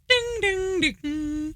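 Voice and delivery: high, ringing ding-ding chime; imitated announcement chime. Chime interrupting your best take, imitated announcement bell. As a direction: sing out the ship's tannoy chime. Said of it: "and then it's like".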